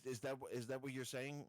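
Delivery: ordinary talk, nothing marked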